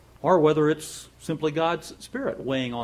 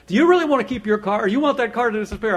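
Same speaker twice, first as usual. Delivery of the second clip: high, loud hard-sell telemarketer; imitating an insistent sales caller; pushy salesman; 9 semitones higher, 9 dB louder than usual. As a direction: press the customer with alarmist questions. Voices pushy salesman on phone.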